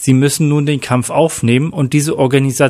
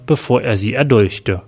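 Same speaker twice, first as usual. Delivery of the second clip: ordinary delivery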